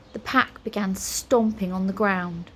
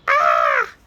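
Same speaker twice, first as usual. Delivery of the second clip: loud, startled "ah" cry; cry of alarm; 8 dB louder than usual. A narrator's reading. Wizard sees his colour going, crying out. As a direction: cry out in sudden fright. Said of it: "cried Flabbergast".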